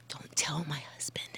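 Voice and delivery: hushed, secretive whisper; conspiratorial whisper. Real, not acted. Confessing a late-night binge behind husband's back, whispered. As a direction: whisper it as a guilty aside.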